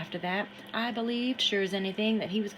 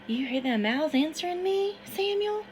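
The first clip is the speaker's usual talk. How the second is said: put-on higher, louder voice of an excited boy in the river; excited Harrison; 9 semitones higher, 3 dB louder than usual. Harrison urges Samuel into the river, higher and louder. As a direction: urge him in, wild and eager.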